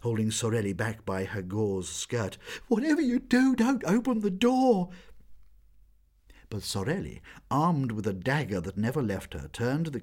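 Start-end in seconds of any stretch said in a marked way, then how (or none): 2.7-4.9 frightened plea. A narrator's reading. Meg pleads, holding Sorelli back.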